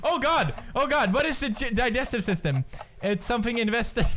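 high-pitched, flustered panic; panicked. Real, not acted. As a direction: blurt it out under time pressure. Timed quiz question, under pressure.

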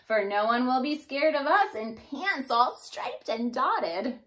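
plain reading voice

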